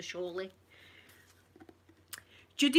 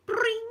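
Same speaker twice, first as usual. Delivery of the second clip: high sharp sung ding; imitated jingle sound; 5 dB louder than usual. Mum mimicking the song's start, high bell note. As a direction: ring out a bright bell note.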